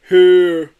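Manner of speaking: loud Yeti call. A narrator's reading. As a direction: call out from the lake. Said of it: "said the Yeti".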